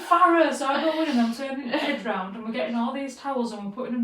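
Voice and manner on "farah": loud, higher exasperated call. Retold or imitated. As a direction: shout her name across the room. Calling friend about the flooding.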